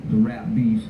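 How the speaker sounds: ordinary talk, nothing marked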